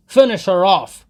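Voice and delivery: very loud, high roar; raging shout. A narrator's reading. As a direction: blind with rage. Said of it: "Mikola shouted".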